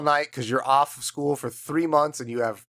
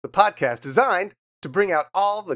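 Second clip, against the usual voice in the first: ad announcer; 5 semitones higher than usual. Pretend. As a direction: sell it like a TV ad. Mock ad opening the podcast.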